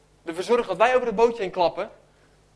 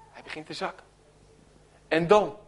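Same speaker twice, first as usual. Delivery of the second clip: usual speaking voice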